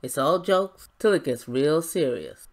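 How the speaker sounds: mock menacing husband after stopping the car; smug power play. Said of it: "cock a hoop at his little power play".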